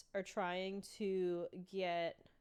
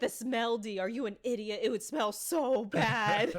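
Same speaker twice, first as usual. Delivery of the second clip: much louder, slow, condescending rant; mock exasperated; 3 semitones higher, 7 dB louder than usual. Dennis berating Dee in therapist's office, hammed-up scorn. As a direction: talk down to her like she's an idiot.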